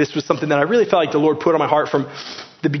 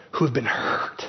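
whispered; 4 semitones lower, 6 dB quieter than usual. Real, not acted. Tender aside to the hurt.

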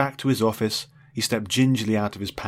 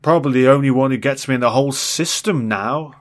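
drowsy rambling; 4 semitones higher, 8 dB louder than usual. Acted. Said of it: "he rambled on drowsily".

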